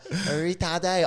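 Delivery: hammed-up French accent, pitched high. Imitating a friend in normal chat, mock French accent. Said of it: "put on a French accent".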